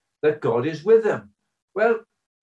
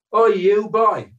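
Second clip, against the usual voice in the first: louder, higher, drawn-out call; teacher's vague shout; 9 semitones higher, 7 dB louder, slower than usual. Retold or imitated. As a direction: shout across the yard at some kid. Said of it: "just shouted vaguely".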